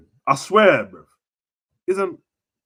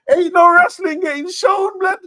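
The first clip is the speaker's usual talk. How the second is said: high, loud, slow hyped yell; hype-man yell; excited shout; 12 dB louder than usual. Real, not acted. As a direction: blast it out even bigger. Same line repeated, much bigger.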